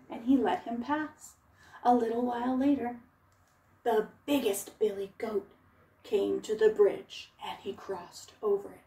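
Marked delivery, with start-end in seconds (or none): none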